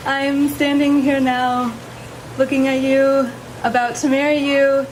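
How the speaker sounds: nervous laughing vows; shy, giggling through her vows; saying wedding vows. Real, not acted. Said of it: "would you please share your vows".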